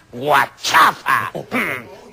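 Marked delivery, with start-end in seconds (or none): none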